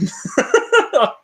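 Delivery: high giggle; high laugh. Real, not acted. Laughs at own story.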